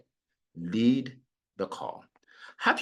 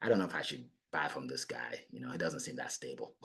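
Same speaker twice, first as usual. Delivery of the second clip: lower, soft muttering doubts; a wary buyer talking to themselves; doubtful buyer; 10 semitones lower, 13 dB quieter than usual. Retold or imitated. Buyer doubts a pushy salesman under their breath. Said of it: "They're like".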